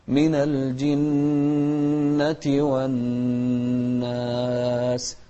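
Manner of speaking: very slow "من الجنة والناس", each doubled nun hummed long; nasalised double nun. Shown as a demonstration. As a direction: hold both nuns of "من الجنة والناس" in the nose. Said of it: "ما نسرع في المقدار". (غنة ممدودة في نوني "من الجنة والناس")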